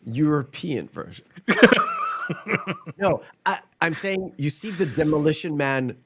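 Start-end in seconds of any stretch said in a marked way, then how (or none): 1.4-2.9 short, high chuckling laugh; quick burst of high chuckles; laughter. Real, not acted. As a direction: chuckle briefly at your own retort. Laughs at own retort.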